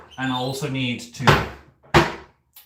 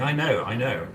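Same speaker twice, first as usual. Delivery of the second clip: ordinary delivery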